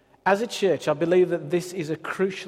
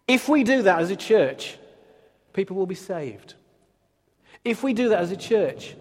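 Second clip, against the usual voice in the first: preaching; 4 dB louder than usual. Acted. Passionate call to surrender.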